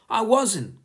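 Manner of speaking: high, loud indignant denial; high-pitched protest; crying out. A narrator's reading. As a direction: yelp it like a boy caught out. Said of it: "cried Bertie".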